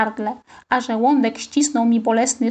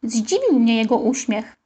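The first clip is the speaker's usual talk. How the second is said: ordinary delivery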